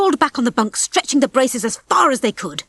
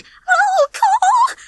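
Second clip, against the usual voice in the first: very high, loud panicking cry; panicked gasp; 5 dB louder than usual. A narrator's reading. Babs panics at the bell, gasping. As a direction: gasp it out in alarm. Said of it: "she gasped".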